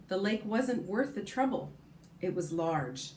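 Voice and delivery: ordinary delivery, nothing marked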